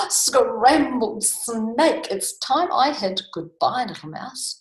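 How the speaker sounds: loud, high mock panic; alarmed snake. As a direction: exclaim in storybook horror, then hurry off. Snake alarmed at being food.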